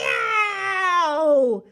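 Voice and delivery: loud, high drawn-out howl; roar. A narrator's reading. Sleeping giant in a nightmare, roaring howl. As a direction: roar in pain, long and high. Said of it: "roared the flesh lump eater".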